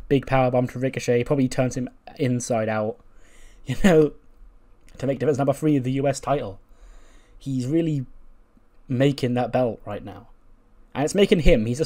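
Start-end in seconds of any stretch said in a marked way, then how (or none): none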